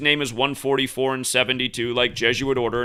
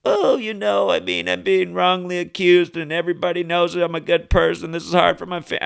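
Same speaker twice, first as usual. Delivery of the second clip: mock whiny self-pity, pitched higher; mocking sappy voice; 5 semitones higher than usual. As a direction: sneer at a politician pleading for sympathy. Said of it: "talks in that slow, sappy voice like he wants your sympathy".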